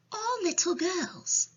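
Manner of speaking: put-on high-pitched little girl voice; small, high child's reply; Sophie. A narrator's reading. Sophie answers the giant.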